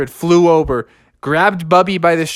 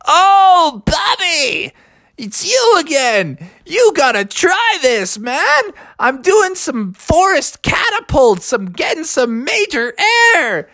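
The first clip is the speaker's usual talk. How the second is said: high, excited owl character; whooping high-pitched daredevil bird; zany excited owl; 12 semitones higher than usual. A narrator's reading. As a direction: cheer on a friend as a wild owl. Alfred the excited owl speaks.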